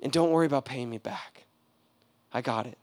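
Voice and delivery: enemy softly telling the patient not to repay; gentle enemy. Retold or imitated. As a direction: reassure him it's covered. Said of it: "he is the one who's like".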